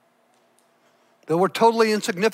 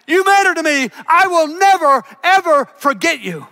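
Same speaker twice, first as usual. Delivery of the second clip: louder and higher, ringing declaration; proclaimed promise; 7 semitones higher, 5 dB louder than usual. Retold or imitated. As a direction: declare God's promise over the whole room. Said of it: "God's promise proclaimed loud".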